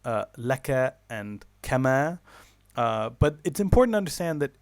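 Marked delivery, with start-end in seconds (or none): 0.1-2.2 laka and kama said slowly, each syllable drawn out; exaggerated pronunciation. Shown as a demonstration. Teacher to students, showing syllable lengths with exaggerated syllables. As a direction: stretch every syllable of laka and kama so the length difference is clear. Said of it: "I know I'm exaggerating a bit".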